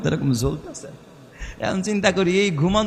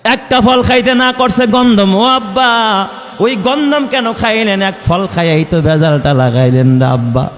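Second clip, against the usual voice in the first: put-on pleading child; 2 semitones higher, 8 dB louder than usual. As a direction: call out to the father, hurt and blaming. Child pleading with father Adam. (অভিমানী সন্তানের উঁচু গলায় নালিশ)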